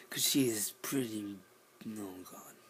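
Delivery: hushed secret whisper. Real, not acted. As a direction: keep it down, whisper. Whispering about mom.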